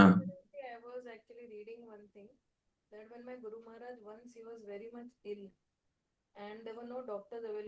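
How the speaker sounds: faint, far-off voice; barely audible. Real, not acted. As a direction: voice almost lost, very faint. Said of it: "Mataji, your voice is very low".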